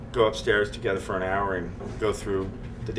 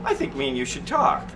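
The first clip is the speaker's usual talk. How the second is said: imitating the boss. [name] imitating the owner announcing himself, high and mock-pompous. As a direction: squeak it out like a self-important owner. Boss voiced in his story.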